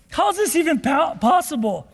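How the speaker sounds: ordinary talk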